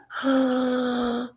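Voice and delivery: long, high-pitched gasp; excited gasp. Real, not acted. Co-host suddenly recalls an old TV show, excited gasp. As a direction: draw a big gasp of recognition.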